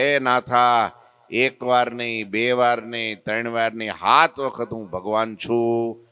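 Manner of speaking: slow, each line weighted; solemn declaration. Acted. Maharaj solemnly answers Natha, slow and weighty. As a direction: proclaim it with measured gravity.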